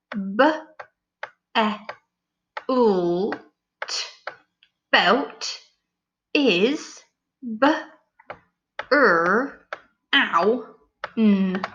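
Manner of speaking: each sound said separately; sounding out letters. Shown as a demonstration. Teacher sounding out the correction. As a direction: segment belt and brown. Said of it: "so it should say".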